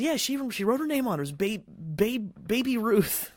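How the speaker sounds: put-on high, fast, innocent kid voice; Scotty explaining the signature, high and naive; clueless kid. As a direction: rattle it off like a naive boy.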